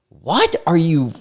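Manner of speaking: slow high disbelief; slow, stunned, high-pitched question; baffled what. Real, not acted. Baffled by the message.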